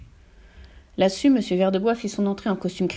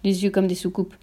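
plain reading voice

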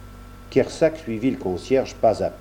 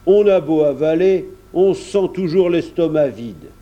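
higher, emphatic farmer's voice; countryman holding forth; 5 semitones higher, 4 dB louder than usual. A narrator's reading. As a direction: pronounce firmly on food. Kersac grumbles about Paris bread.

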